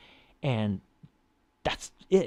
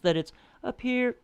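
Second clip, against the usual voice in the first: high tone; 13 semitones higher, 3 dB louder than usual. Shown as a demonstration. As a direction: voice lifted very high. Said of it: "the high tone isn't just".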